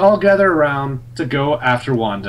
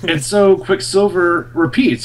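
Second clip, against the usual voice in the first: normal talking voice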